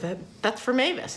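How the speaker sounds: high-pitched mock amazement; imitated excited squeal; retold excited realisation. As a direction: blurt it high, as a sudden realisation. Retelling her delighted realisation.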